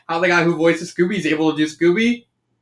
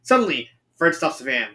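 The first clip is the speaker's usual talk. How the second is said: plain reading voice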